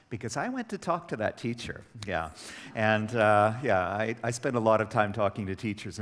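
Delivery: ordinary talk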